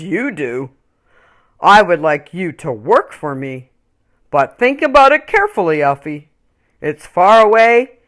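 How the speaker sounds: a big man greeting warmly; kindly big man. Acted. Big man's offer.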